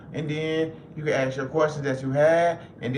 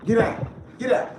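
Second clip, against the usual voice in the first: higher repeated commands; shooing the cat; 7 semitones higher than usual. Real, not acted. Chasing the cat off his laptop, raised repeated commands. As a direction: shoo it off over and over.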